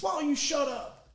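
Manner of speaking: high-pitched mock yell without the volume; retold dugout yell. Player mimicking rival dugout telling coach off, mild mock yell. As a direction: jeer it high but held back. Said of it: "somebody from their dugout yells".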